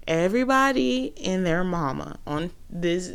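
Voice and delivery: normal talking voice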